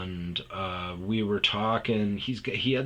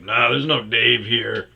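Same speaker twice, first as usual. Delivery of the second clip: a short-fused, louder brush-off; curt brush-off; 2 semitones higher, 10 dB louder than usual. Retold or imitated. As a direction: snap it off like a bored bartender. Retold phone answer, louder.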